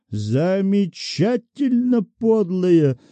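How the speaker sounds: slow, drawn-out mild outrage; indignant. A narrator's reading. Bank director fuming about the petitioner, mildly indignant. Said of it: "возмущался Кистунов".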